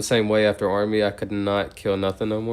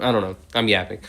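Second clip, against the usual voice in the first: ordinary talk, nothing marked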